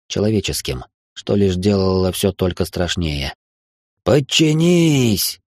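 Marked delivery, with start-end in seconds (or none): 4.0-5.4 high, long-drawn cry; shouted command. A narrator's reading. Terrorist orders the host to submit, a high drawn-out shout. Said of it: "выкрикнул один из террористов".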